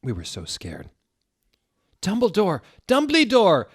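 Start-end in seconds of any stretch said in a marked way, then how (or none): 2.1-3.6 high, loud frantic yell. A narrator's reading. Ebony and Vampire yelling for Dumbledore. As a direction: call out desperately up the stairs. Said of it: "we both yelled".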